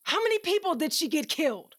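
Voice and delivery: high, loud and furious, shouting back; angry outburst. Acted. Tasha yells at Evie about the people killed, furious. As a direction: yell it at them, full of rage.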